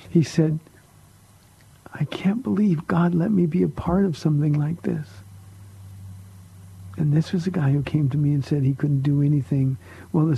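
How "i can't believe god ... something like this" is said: an awed, unvoiced whisper through tears; tearful whisper. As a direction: breathe it out, overwhelmed and close to crying. [name] crying at ministry opening.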